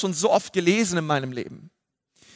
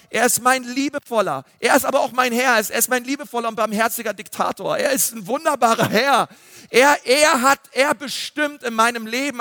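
higher, driving sermon voice; fervent preaching; 4 semitones higher than usual. Acted. Preacher proclaiming God's lordship to his listeners, fervent. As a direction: preach it with rising fervour.

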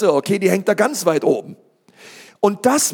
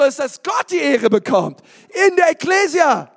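full-force preaching; 7 semitones higher than usual. Acted. Pastor fires up the congregation, preaching at full force. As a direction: preach it out with fire.

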